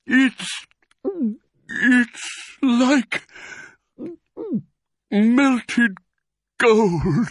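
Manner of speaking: high, strained, halting wonder; gasping. A narrator's reading. Badger after gulping cider, overcome gasps. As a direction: gasp between words, long gaps. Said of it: "he gasped".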